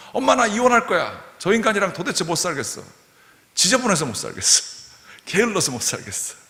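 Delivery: exasperated grown daughter venting; fed-up daughter. Retold or imitated. Daughter telling her mom she'll divorce, exasperated whining.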